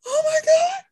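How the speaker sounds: very high, breaking with laughter and tears; overwhelmed squeal. Retold or imitated. Meeting a host who knows her show, tearful happy squeal.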